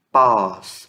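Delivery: "Pós" loud, low, with open O; open vowel demo. Shown as a demonstration. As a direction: sound out Pós with a wide open O. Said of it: "Aqui é um som mais aberto".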